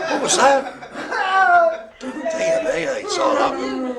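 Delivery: high, squeaky words through real laughter; can't stop laughing while talking. Real, not acted. Cracking up at a noise.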